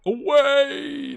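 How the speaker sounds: loud high yell; shout. Real, not acted. Yelling for the defender to clear it. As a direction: call it clear with force.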